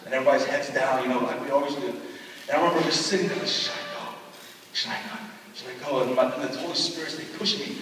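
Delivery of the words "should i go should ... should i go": hushed, torn inner whisper; anxious whisper. Retold or imitated. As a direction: whisper to yourself, unsure whether to go. Boy torn about going forward.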